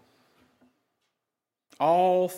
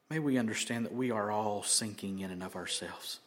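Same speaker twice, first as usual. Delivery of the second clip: prayer; 3 dB quieter than usual. Acted. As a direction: pray quietly on behalf of everyone. Pastor leads the congregation in prayer.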